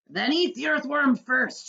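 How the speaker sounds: loud, slow, high shout. A narrator's reading. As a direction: bellow it to save your own skin. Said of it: "shouted the centipede".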